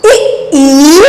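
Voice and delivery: इ very short, then ई held long; quick इ, drawn-out ई; short then long vowel. Shown as a demonstration. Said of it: "आपको कैसे पढ़ना है इसको". (छोटी इ, लंबी ई)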